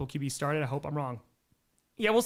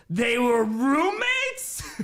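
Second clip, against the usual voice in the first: mock innocent question; 8 semitones higher, 5 dB louder, much slower than usual. Teasing about roommates.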